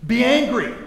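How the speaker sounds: loud, high, forceful; quoted command. Retold or imitated. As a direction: proclaim it like a command. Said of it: "Paul says this".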